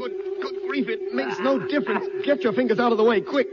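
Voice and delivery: high, strained warning shout; urgent alarm. Acted. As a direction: warn him frantically to get clear. Urgent warning as hatch closes.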